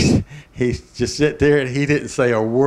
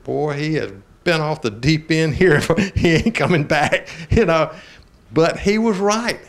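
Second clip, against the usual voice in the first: laughing through it; 5 semitones higher, 4 dB louder than usual. Retold or imitated. Retold own remark, laughing.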